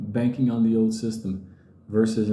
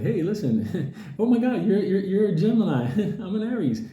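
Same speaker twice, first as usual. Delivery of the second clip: higher, giggly flirty stranger; mock flirty chatter; 7 semitones higher than usual. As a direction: play a giddy stranger chatting in bed, laughing. Mocks a flirty bed-sharing stranger.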